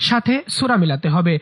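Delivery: ordinary talk, nothing marked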